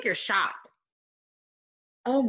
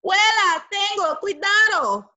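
high, loud warning to grandma; warning shout; 9 semitones higher, 5 dB louder than usual. Acted. Boy warns grandma about the octopus, loud and high.

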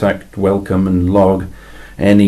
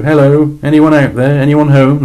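higher, louder, calling out into the void; playfully imitating the humans' message to space; mock radio greeting; 7 semitones higher, 5 dB louder than usual. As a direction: hail the stars like a hopeful stranger. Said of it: "That's the message they're sending out by radio".

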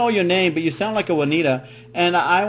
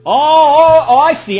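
big hammed-up "oh, oh, I see", higher and louder; mock realization; 10 semitones higher, 10 dB louder, slower than usual. Prank caller pretending to finally understand, high and loud. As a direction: overplay the lightbulb moment.

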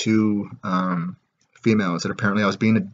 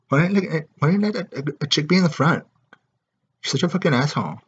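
mock angry girl; 6 semitones higher than usual. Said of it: "He's like".

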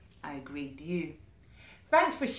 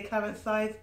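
ordinary delivery, nothing marked

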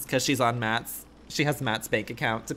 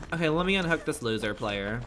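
ordinary delivery

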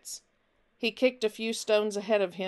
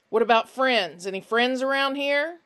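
louder, smooth questioning; fake-friendly Finch; 4 semitones higher, 6 dB louder than usual. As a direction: repeat the name and pry, high and insistent. Finch coaxes Nick.

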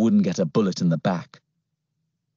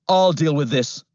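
policeman voice; 6 semitones higher, 3 dB louder than usual. A narrator's reading. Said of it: "he ordered".

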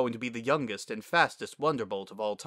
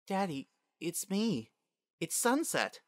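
high, slow, tentative voice; tentative daughter; 9 semitones higher, 3 dB quieter than usual. Acted. Long-lost daughter facing her father, tentative. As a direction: softly plead to be recognised.

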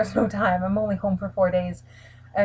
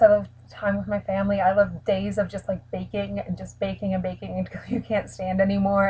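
usual speaking voice

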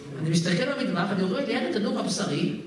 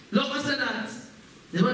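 ordinary talk, nothing marked

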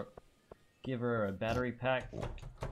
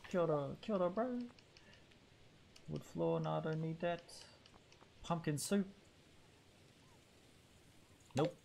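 Kiwi villager voice; 6 semitones higher, 4 dB quieter than usual. Acted. Voicing villager, higher far voice.

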